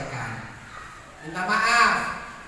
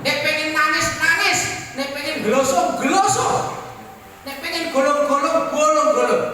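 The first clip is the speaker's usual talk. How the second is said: rising urging; 5 semitones higher, 3 dB louder than usual. Acted. Preacher urging congregation to weep.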